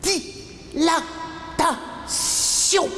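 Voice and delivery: Dilatation very slow, high, syllable by syllable; stretched-out syllables. Acted. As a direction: leave long gaps inside Dilatation. Same word stretched out further.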